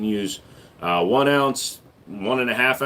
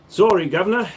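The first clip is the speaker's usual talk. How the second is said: put-on higher, botched English accent drifting toward Aussie; attempted English accent; 6 semitones higher, 4 dB louder than usual. Streamer apologises to a London viewer, shaky-attempt English accent. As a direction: have a rough go at an English accent. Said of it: "trying to do an, an, an English accent".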